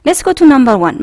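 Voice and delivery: normal talking voice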